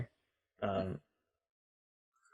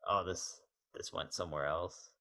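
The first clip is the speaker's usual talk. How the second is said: quiet deflated aside; 3 semitones lower, 10 dB quieter than usual. Retold or imitated. Bored reaction retold.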